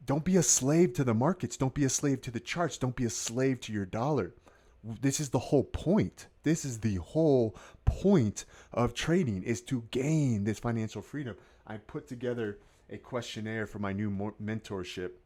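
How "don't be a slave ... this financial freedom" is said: rousing call. Real, not acted. Rousing motivational climax.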